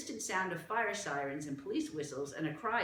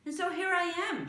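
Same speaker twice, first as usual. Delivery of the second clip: put-on lightly raised, eager girl's voice; a curious little girl, higher than the narration; young Martha; 6 dB louder than usual. Martha asks the small gentleman, eager girl voice. Martha finishing her tale.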